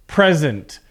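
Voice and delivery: "Present" stressed on the first syllable, PREZ-ent; noun stress PRESent. Shown as a demonstration. Teacher shows the class the noun form, stress first syllable. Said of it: "the word sounds different".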